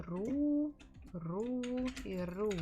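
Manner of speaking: Ру with the stress, high; Ру stressed first syllable. Shown as a demonstration. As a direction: hit the ру of Русское. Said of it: "So this is the stress".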